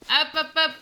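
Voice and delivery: high, loud singsong hops; bouncy hop hop. Real, not acted. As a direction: bounce through it cheerfully. Cheerful action in game.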